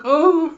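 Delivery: very high, deflated little oh; mock whimper. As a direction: whimper like a dog losing its nerve. Dog's facade collapses.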